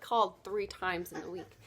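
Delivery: usual speaking voice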